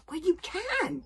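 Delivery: high-voiced, stubborn friend; stout insistence. Fishlegs insists to Hiccup that he can, stoutly. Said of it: "said Fishlegs stoutly".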